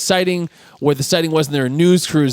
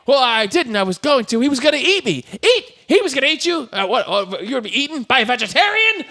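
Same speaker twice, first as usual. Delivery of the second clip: high-pitched back-and-forth squabble; incredulous, hammed-up bickering; mock heated argument; 8 semitones higher than usual. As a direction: act out both sides, voice pitched up. Acted quarrel, biker and George.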